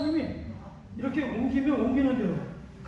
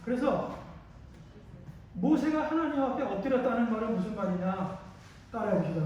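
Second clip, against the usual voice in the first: ordinary talk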